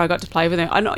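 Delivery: normal talking voice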